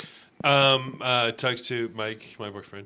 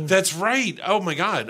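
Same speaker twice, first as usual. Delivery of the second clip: gasping, louder and higher realization; sudden realization; 9 semitones higher, 6 dB louder than usual. Real, not acted. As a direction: gasp then a loud, high "That's right". Suddenly remembers forgotten friend.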